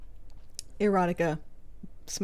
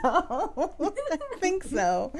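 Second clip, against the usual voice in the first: laughing; 9 semitones higher, 3 dB louder, much slower than usual. Real, not acted. Laughing agreement, drawn out.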